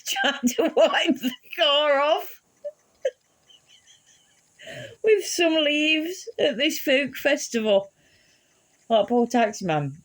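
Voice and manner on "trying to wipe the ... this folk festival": slow, high words broken by laughter; laughing. Real, not acted. Describing mum wiping the cab, slow laughing delivery. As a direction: let the laugh stretch the words out.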